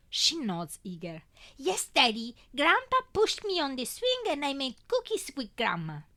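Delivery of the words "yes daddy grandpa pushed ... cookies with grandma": put-on little girl. A narrator's reading. Toddler tells father about grandparents.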